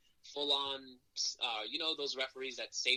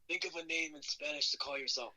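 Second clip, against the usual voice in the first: raspy impression, pitched up; Dean Malenko; 5 semitones higher than usual. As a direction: rasp it out like an old wrestling coach. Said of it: "he's with his raspy voice".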